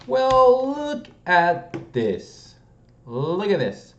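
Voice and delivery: very high, loud, drawn-out exclamation; playful amazed delight. Real, not acted. Solver showing viewers a lucky find, playfully thrilled. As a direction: crow over the discovery.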